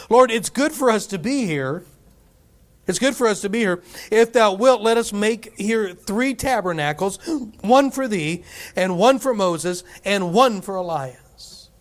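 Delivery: preacher voicing Peter's eager plan. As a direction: Peter bursting with eagerness, slowing at the end.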